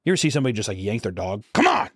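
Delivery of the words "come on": high, sudden mock holler; impatient command. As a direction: call out as if yanking a dog's leash. Imitating yanking a dog along.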